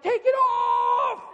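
high, loud drawn-out demanding yell; yelling child. Retold or imitated. Son calls to dad walking out, screaming. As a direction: imitate a boy hollering after his dad.

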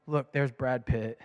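low, slow and deadpan; bored monotone; flat, unexcited. Shown as a demonstration. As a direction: point out a star like it's nothing. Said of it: "I probably would not say".

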